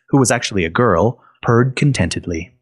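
ordinary delivery, nothing marked

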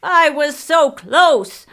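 slow, loud, high anguished yell; shouting. A narrator's reading. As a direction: wail it out in thwarted rage. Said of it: "he shouted".

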